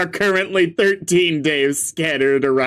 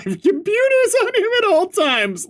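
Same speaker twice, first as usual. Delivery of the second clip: witch voice. Acted. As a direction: read it like a cartoon witch. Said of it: "I'm giving her a witch voice".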